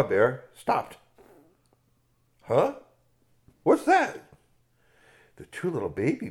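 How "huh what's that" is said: much higher puzzled question; startled huh. Acted. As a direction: ask it like a puzzled bear. Mother bear startled by song.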